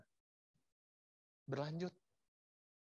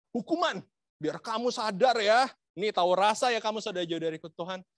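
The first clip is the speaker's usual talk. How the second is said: mock scolding; 3 semitones higher, 8 dB louder than usual. Voicing a punishing God.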